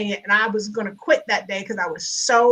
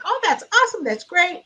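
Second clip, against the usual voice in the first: lilting, higher-pitched mock enthusiasm; producers' enthusiasm; 7 semitones higher than usual. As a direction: imitate upbeat producers, pitch jumping up. Retelling enthusiastic show producers.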